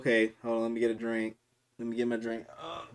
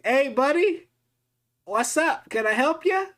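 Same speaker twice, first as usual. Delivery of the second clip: much higher, louder mock-polite voice; nervous friendly greeting; 6 dB louder than usual. To the man banging on his door, high nervous politeness. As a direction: play it overly nice and high to calm a threat.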